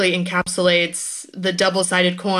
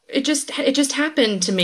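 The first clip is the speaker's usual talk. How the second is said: higher, helpless-sounding mock naivety; naive baby woman; 8 semitones higher than usual. Guest imitating a reality star dodging responsibility, mock-naive higher voice. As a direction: imitate a self-infantilizing innocent. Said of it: "it's a very young and naive".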